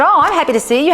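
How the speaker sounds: high-pitched, demonstrative delight; happy greeting. Retold or imitated. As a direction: greet a child with acted joy. Happy greeting to child in game.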